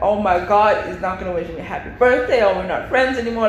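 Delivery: ordinary talk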